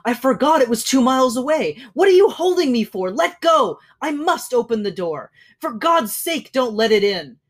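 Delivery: high, loud panicked shouting; frantic shouting. A narrator's reading. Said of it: "cried the old man".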